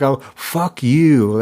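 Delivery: slow, drawn-out mild angry curse. Shown as a demonstration. Showing how an angry curse sounds, drawn-out anger. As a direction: draw out the swear with some anger. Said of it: "and that's an angry curse".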